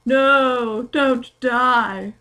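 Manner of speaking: hammed-up posh pleading, high and loud; pleading Satan. Reading a bad fanfic aloud, Satan begs in a hammed-up posh voice. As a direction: overact a dying plea, loud and high.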